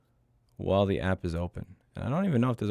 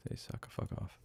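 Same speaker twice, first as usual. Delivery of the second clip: ordinary delivery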